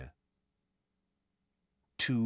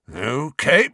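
higher, louder forced composure; forced calm; 7 semitones higher, 6 dB louder than usual. A narrator's reading. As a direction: hold the calm with effort. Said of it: "in a voice of forced calm".

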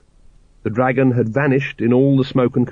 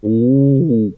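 ape hoot; 3 dB louder than usual. A narrator's reading. Librarian ape answering Vimes, hooting. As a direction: hoot like an orangutan.

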